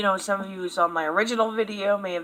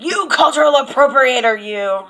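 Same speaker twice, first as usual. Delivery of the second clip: hammed-up accusation, higher and louder; mock accusing yell; 5 semitones higher, 10 dB louder than usual. Mocking online accusers, a loud hammed-up yell. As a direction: yell it like an outraged commenter. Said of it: "than coming out and be like".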